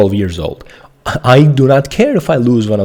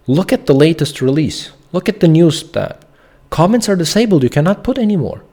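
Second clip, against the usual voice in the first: pissed-off rant; 6 semitones higher than usual. Real, not acted. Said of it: "I am pissed".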